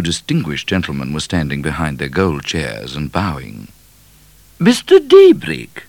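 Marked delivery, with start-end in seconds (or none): 4.6-5.8 high, loud royal proclamation; proclaiming queen. A narrator's reading. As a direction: announce him grandly in a high queenly voice. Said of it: "proclaimed the Queen".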